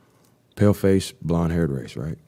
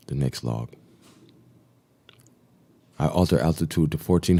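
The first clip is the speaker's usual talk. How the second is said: steady, slightly raised log-reading voice; diary reading; 2 semitones higher, 5 dB louder than usual. Acted. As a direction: read the flight log like a pilot's report. Reads explorer's flight log aloud.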